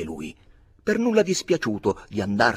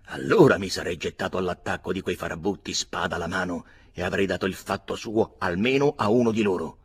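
plain reading voice

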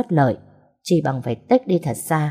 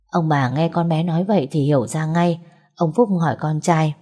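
usual speaking voice

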